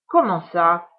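loud outraged witch; fuming witch taken aback; indignant witch. Acted. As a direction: snap back, offended. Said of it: "fulmina Cornebidouille indignée".

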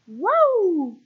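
high, loud, drawn-out whoop of wonder; awestruck cry. A narrator's reading. Little fish sees the whole whale from afar, crying out. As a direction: shout your amazement from afar. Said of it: "cria Argento de loin".

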